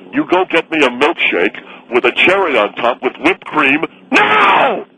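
bellowing order, ending in a scream; yelling. Acted. Yelling at the diner cook.

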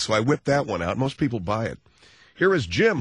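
ordinary delivery

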